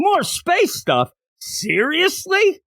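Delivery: high, drawn-out disbelief without real volume; hammed-up incredulous complaint; mock yell. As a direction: imitate an exasperated yell, slow and high. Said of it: "Tatsumi yells".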